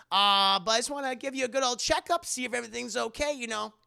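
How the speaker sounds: high, loud, quickening fake-cheerful patter; put-on chipper bedside voice; mock breezy doctor. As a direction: brush past the grief brightly. [name] switches to checkup patter.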